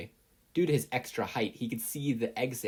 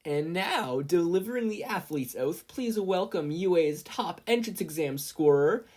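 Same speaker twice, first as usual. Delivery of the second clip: higher, louder, slow stage announcement; Midnight announcing; 8 semitones higher, 6 dB louder than usual. A narrator's reading. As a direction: present the speaker grandly. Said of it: "Midnight announced".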